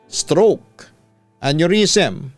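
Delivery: plain reading voice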